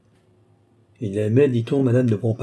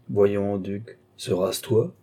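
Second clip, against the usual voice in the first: ordinary delivery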